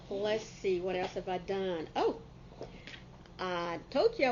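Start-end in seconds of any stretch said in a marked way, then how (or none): none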